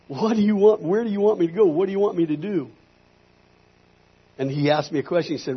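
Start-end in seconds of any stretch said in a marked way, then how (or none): 0.1-2.7 high, insistent questions; desperate questioning. Retold or imitated. Minister retells asking God for direction, desperate. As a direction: plead for an answer in a raised pitch. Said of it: "I'm getting desperate".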